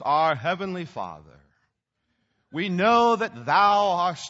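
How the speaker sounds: slow, stiff, archaic-sounding prayer voice; mock-solemn King James praying, dragging the words; pompous formal prayer. Shown as a demonstration. Preacher mocking a stiff formal prayer, hammed-up and slow. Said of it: "don't pray like this".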